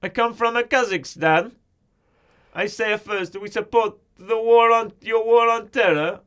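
put-on slow, high foreign-accented speech; Borat. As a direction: declaim slowly in a comic foreign accent. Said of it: "to do Borat's voice".

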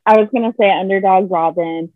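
ordinary talk, nothing marked

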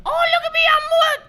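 very high, loud squeaky character voice; Mort. Retold or imitated. Imitating Andy interrupting in character, very high squeal. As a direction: pipe it up into a tiny falsetto. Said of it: "Andy just went".

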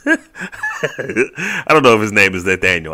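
laughing through the words at his own joke; chuckling as he admits he made the name up. Real, not acted. Podcaster laughing at his joke name for the coach, laughing.

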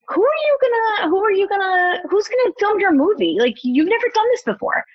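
high, anxious self-questioning; doubting inner voice. Retold or imitated. Mocking her own doubts to the listener, high and anxious. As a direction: squeak the worried questions way up high.

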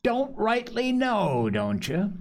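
high, drawn-out mocking repeat; mocking echo. A narrator's reading. Silver mocks Morgan's feeble answer, scornfully. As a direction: throw it back slowly, high then dropping.

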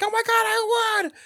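squealing high joy; mock delighted win. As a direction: shriek like you just won. Woman winning lottery.

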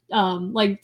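ordinary talk

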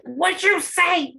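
high, slow, drawn-out squeal; imitating the girl. Imitating the girl next to him, high and drawn out. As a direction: mimic the classmate's high astonished squeal. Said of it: "That's literally how she sounded".

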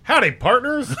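high, hearty cowboy howdy; cowboy greeting. Pretend. Buddy greets a room of women, hearty cowboy howdy. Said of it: "that's my favorite right there".

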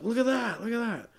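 high, light cry of wonder; awed onlookers. Acted. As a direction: point it out, pitched well up. Crowd pointing at the light.

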